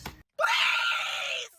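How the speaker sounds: high, loud pleading shout; begging shout. Real, not acted. Begging viewers to subscribe.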